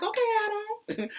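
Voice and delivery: very high, thrilled squeal; excited squeal. Retold or imitated. Reacting to Adam Sandler trailer, high excited squeal. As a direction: shriek his name with delight. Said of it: "That's how you know I'm excited".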